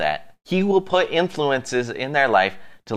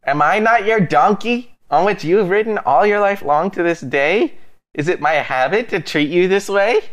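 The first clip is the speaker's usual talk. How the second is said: higher, earnest talking donkey; pleading donkey voice; 5 semitones higher than usual. Retold or imitated. Donkey reasoning with its angry rider, lightly voiced. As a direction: make the donkey plead its case. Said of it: "the donkey says to Balaam".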